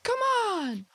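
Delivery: high, slow belted rally cry; mock rock yell. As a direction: yell it like a stadium chorus. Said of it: "in the song where you just yell".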